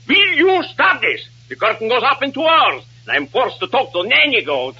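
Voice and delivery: high-pitched, indignant scolding; exasperated outburst. Acted. Director with curtain in two hours, exasperated outburst. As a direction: blow up at the cast.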